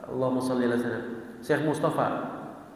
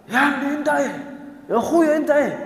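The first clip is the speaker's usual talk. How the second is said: yelling conductor; 9 semitones higher than usual. Retold or imitated. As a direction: bark it out like a terminal conductor calling. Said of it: "Kenek yang di terminal itu teriak". (teriakan kenek menirukan)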